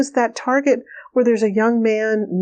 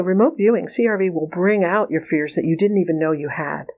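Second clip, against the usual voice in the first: ordinary delivery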